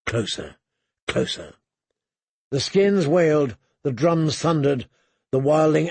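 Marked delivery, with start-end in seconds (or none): 0.1-1.5 quiet and much lower, falling; hushed, low-pitched urging; low, tense waiting. A narrator's reading. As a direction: murmur it low, holding for the moment. Commander waiting as enemy nears.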